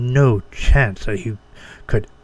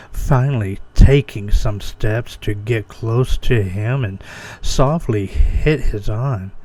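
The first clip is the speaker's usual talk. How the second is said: plain reading voice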